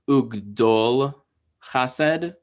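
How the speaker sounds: Ugdol Chased, slowly, short O in "dol", pause before Chased; demonstrated pronunciation. Shown as a demonstration. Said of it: "which is pronounced".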